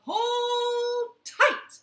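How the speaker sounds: high, loud shout of the magic words; yell. Acted. Peter yells the magic words at the goose, loud high shout. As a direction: belt out the spell. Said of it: "and Peter yelled".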